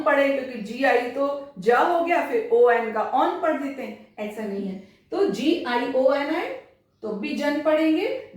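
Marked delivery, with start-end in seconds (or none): none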